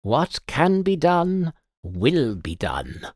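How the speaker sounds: slow, measured pronouncement; firm declaration. Acted. Mentor declaring to the guests, measured and slow. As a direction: declare it with calm authority. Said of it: "my silver-haired mentor declared".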